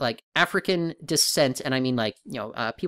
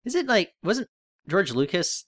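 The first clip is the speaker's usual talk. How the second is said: usual speaking voice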